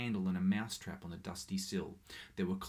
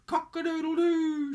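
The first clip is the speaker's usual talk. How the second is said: very high, loud held crow; child crowing cock-a-doodle-doo; rooster crow; 10 dB louder than usual. A narrator's reading. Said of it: "shrilled Isabel".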